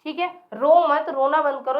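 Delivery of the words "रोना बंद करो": usual speaking voice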